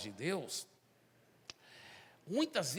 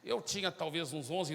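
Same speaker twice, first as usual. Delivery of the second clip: usual speaking voice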